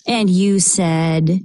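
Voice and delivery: usual speaking voice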